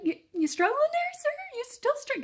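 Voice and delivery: very high, sing-song mock concern; sarcastic mockery. Cranky patient mocking the nurse's second failed try, high sarcastic voice. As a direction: tease him in a high put-on voice.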